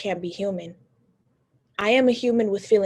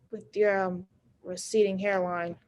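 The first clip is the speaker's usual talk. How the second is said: ordinary delivery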